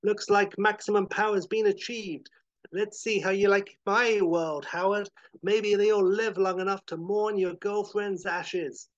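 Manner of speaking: high, loud villain's taunt; gloating villain. Acted. Chet threatens Howard at the rift, gloating loudly.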